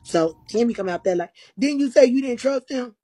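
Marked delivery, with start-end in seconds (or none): 1.6-2.9 accusing question. Retold or imitated. Quoting Cammy's accusing question.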